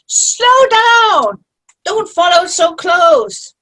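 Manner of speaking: high, loud mock shriek; imitating her yelling. Friend mimicking Jean yelling in the car, shrill mock shout. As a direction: ham up her backseat yelling. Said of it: "You should have seen her coming here in the car".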